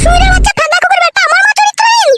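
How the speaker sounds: very high, indignant screech; outraged. Acted. Wife hears her mother insulted, outraged high-pitched yelling.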